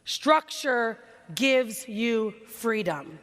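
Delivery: very slow, every word weighted; slow, emphatic. Shown as a demonstration. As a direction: lay it down word by word. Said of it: "I need you to write this down".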